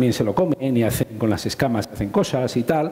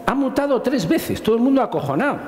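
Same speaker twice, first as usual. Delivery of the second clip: much higher, hammed-up panic on "Ha mutado tres veces"; mock alarm; 9 semitones higher than usual. Mocking the panic over a headline, much higher and hammed-up. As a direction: play the public's fright, half joking.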